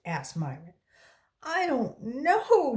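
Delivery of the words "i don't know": sobbing. A narrator's reading. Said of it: "Dana sobbed".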